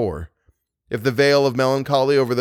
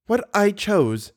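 exasperated; 9 semitones higher, slower than usual. A narrator's reading. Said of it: "driven to exasperation".

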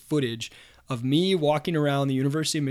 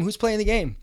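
imitated reporter asking once more; pushy reporter, easing off; 7 semitones higher than usual. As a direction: repeat the question, slightly deflated. Reporters crowding the hapless guy.

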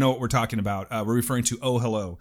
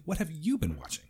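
unvoiced whispered question; playful stage whisper; 3 semitones higher, 8 dB quieter than usual. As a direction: whisper it like a secret.

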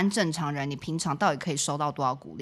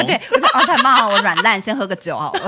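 loud, high, laughing celebration; giddy, loud joking delight; gleeful laughing; 4 semitones higher, 9 dB louder than usual. Real, not acted. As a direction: crow with relief through laughter. Jokes about relaxing as non-host.

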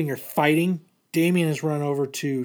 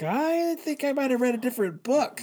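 higher, puzzled aside; mock bafflement; 9 semitones higher than usual. Telling his friend the book seemed different, in mock bafflement. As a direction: act confused, like he missed something. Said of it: "I was like".

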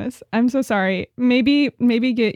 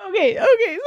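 normal talking voice

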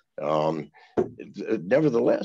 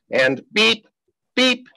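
mimicking a TV censor bleep, the second far higher; 13 semitones higher, 6 dB louder, slower than usual. Mocking the CNN censor bleeping his swearing, imitated beep. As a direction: imitate the bleep noise, rising sharply. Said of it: "every three seconds there's".

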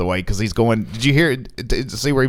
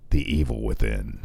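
spooky deep voice; 7 dB quieter than usual. Pretend. Spooky movie title, very low.

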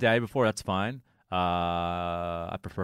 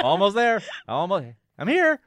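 laughing mock announcement; 11 semitones higher, 4 dB louder than usual. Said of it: "I keep it really regal".

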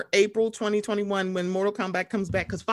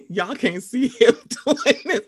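laughing; 3 semitones higher, 4 dB louder than usual. Real, not acted. Watching cohost's antics, laughing hard. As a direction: laugh through the words.

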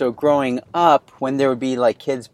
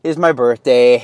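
bright, raised show-opening announcer voice, slowed down; podcast host intro; 4 semitones higher, 5 dB louder, slower than usual. Acted. Host welcoming listeners to the birthday episode, announcer style. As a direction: open the show like a radio host.

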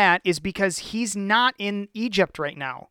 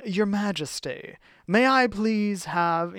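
formal courtly; slower than usual. Acted. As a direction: speak to a king properly. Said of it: "you're saying".

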